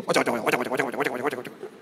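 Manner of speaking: a playful imitation of Oggy's cockroaches babbling "ocok"; cockroach gibberish. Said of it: "jadi ngomongnya cuman gini nih".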